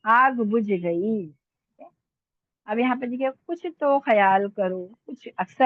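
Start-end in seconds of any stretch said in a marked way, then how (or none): 0.1-1.3 slow dictation. Shown as a demonstration. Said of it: "इस पे जेर जबर पेश लिखा नहीं".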